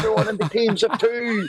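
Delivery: high, laughing host call; high laughing announcement. Retold or imitated. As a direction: call it out laughing, over-bright.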